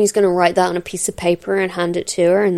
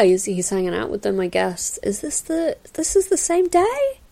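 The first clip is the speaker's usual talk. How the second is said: normal talking voice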